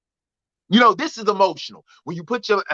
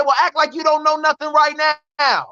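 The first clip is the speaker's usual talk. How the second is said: higher-pitched, indignant comeback; retold sassy comeback, pitched up; exasperated retort; 8 semitones higher than usual. Son answering his mother's excuse, exasperated retort.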